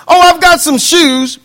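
mock excuse voice. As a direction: play the lazy believer with a slow whiny excuse.

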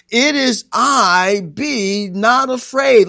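slow, high, ringing declaration; loud proclamation. Acted. Jesus declaring himself to the disciples, loud slow proclamation. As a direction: stretch each word at full pulpit force. Climax of preached Jesus speech.

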